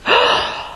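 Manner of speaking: sharp, high gasp, re-enacted; shocked gasp. Patient seeing the anesthesiologist's mask, shocked gasp. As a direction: suck in air as if hit in the heart.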